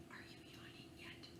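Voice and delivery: fast faint whisper; tiny teasing whisper. Shown as a demonstration. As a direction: lean in and whisper the question. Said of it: "asks listener in a whisper".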